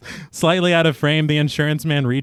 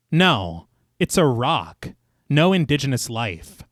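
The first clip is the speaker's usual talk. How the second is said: measured, lower narrator's read; story narration; 3 semitones lower, 3 dB quieter than usual. A narrator's reading. Narrator recaps the inquiry scene for listeners, measured storytelling. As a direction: read it like a polished audio storyteller. Reads scripted film recap.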